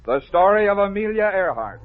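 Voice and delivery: ordinary delivery, nothing marked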